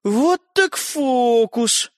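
very slow, drawn-out vexed sigh of words; with annoyance. A narrator's reading. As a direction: drag it out, annoyed. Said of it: "с досадой проговорил".

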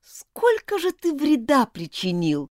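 every word a reproach; angry. Acted. Elizabeth reproaches Martin, slow and angry. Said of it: "сказала Элизабет и сама ещё больше разозлилась".

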